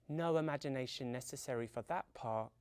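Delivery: ordinary delivery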